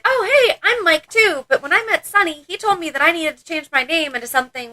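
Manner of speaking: high, chirpy mock introduction; acted-out friendly greeting, high-pitched; imitating chatty newcomer. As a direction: pitch it way up, playing an oblivious chatterer. Acts out chatty Mike introducing himself.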